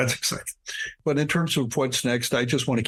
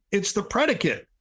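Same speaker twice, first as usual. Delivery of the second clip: retold yell; 9 semitones higher than usual. Waking his wife in the night with a high mock yell. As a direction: repeat it much higher, as a mock yell. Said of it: "and yelled".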